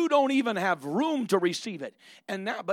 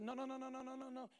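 soft repeated no; 8 semitones higher, 16 dB quieter, faster than usual. Acted. God gently refusing.

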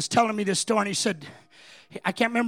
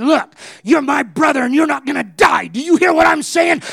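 desperate shouting, much higher and louder; screaming at brother; 8 semitones higher, 13 dB louder than usual. Retold or imitated. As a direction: yell to keep him alive. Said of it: "started screaming at him".

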